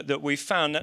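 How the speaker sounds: usual speaking voice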